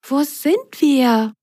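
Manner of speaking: high, slow little sock voice; Belly asking. Acted. Belly waking in the dark, asking where they are. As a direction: wake up lost and wonder. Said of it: "fragte Belly".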